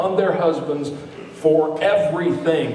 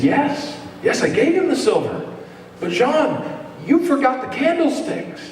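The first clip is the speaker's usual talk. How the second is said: kindly priest; 7 semitones higher than usual. Retold or imitated. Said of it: "And he said".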